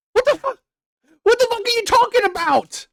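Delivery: high, incredulous what the fuck; shocked by what she wrote, pitch leaping up; outraged disbelief. Real, not acted. Streamer reacting to the chat, high shocked outburst.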